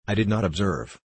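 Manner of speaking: ordinary delivery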